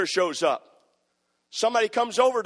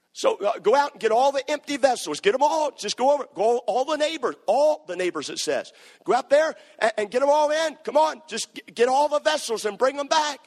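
preaching; 5 semitones higher than usual. Acted. Preaching at full force.

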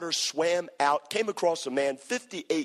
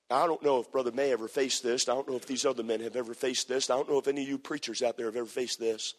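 usual speaking voice

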